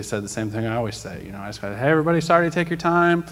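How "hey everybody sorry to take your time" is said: loud, higher, fast call for attention; calling out to a crowd. Retold or imitated. Announcing herself to grumpy travelers at the gate, loud and fast. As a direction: call over the whole waiting area.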